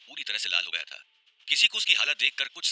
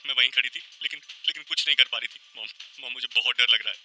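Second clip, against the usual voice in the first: frightened, sustained near-crying wail; tearful voice; 3 semitones higher, 5 dB louder than usual. A narrator's reading. Tanya recounting her nightmare to mom, tearful. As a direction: pour out the nightmare, close to sobbing. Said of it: "Tanya ने रुआंसी आवाज में कहा".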